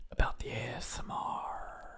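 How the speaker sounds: soft ASMR whisper. Pretend. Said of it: "Why are you whispering?".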